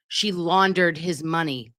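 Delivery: ordinary talk, nothing marked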